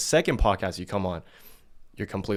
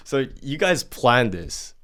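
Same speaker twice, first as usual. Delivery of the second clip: usual speaking voice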